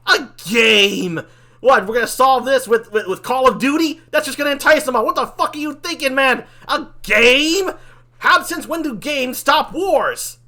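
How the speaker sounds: loud, high-pitched incredulous rant; incredulous mockery. Demon mocking angel friend's game idea, incredulous shouting.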